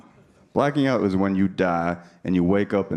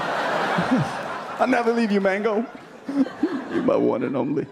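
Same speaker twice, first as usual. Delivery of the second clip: slow, laughing mock vow, pitched higher; mock love vow; 11 semitones higher than usual. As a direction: croon it like a lovesick partner.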